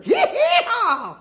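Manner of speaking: high, loud yee-haw holler; cowboy whoop. Acted. Cowboy host cheers for Oklahoma, high whooping holler.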